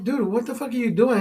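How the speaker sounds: high-pitched mock outrage; retold indignant challenge. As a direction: replay your challenge to a cop. Retells arguing with a cop.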